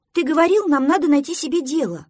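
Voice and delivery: high, loud duckling reproach; reproachful protest. Acted. Duckling stops friend going to bed, loud reproach. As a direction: call him out, pitched high.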